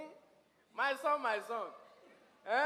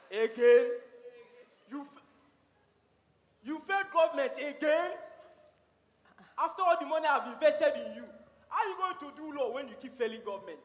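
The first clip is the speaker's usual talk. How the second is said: loud exasperated scolding; angry father; 3 semitones higher, 7 dB louder than usual. Acted. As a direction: blow up at the failing child. Father scolding failed daughter.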